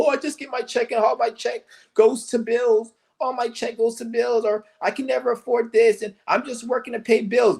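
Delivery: whiny mock complaining voice; mocking whiner. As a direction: imitate people moaning about bills.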